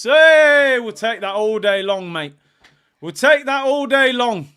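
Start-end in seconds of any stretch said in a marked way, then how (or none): 0.0-0.8 excited shout. Real, not acted. Trent's shot goes wide. 3.0-4.5 higher and louder, celebrating; louder cheer. Real, not acted. Fan cheering a missed shot, loud and high.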